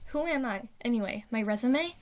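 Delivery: ordinary talk, nothing marked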